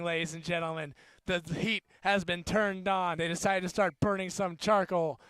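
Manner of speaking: rugby commentary, rising with each run; live play-by-play. Acted. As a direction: call the match as it happens.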